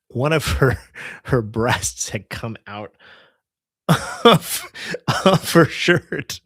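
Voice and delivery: slow, broken up by laughter; laughing through words. Real, not acted. Driver telling viewers an embarrassing detail, laughing through it. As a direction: struggle to get the words out for laughing.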